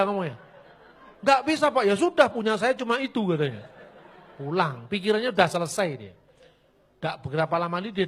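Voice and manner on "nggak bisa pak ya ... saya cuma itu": flustered protest. Retold or imitated. Flustered official refuses loudly.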